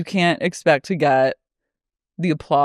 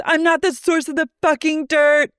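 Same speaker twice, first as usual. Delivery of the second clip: higher, fed-up rant; mock Lisa; 9 semitones higher than usual. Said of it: "She goes".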